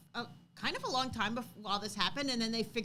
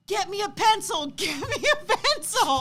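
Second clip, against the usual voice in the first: high, loud yelling breaking into a laugh; retold yell with laughter; 11 semitones higher, 10 dB louder than usual. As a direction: laughingly imitate a boy yelling for a pencil. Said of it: "I was yelling".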